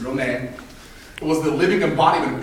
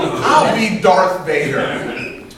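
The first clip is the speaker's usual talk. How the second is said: slow dramatic declaration; 3 semitones higher, 5 dB louder, much slower than usual. Retold or imitated. Five-year-old claiming the villain role, slow and grand. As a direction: draw out I'll be Darth Vader very slowly. Kid choosing his role.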